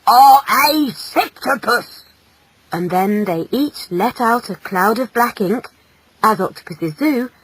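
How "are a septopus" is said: slow, loud condemnation; drawn-out, accusing anger; angrily. Acted. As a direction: pronounce it like a furious father's verdict. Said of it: "said his father angrily".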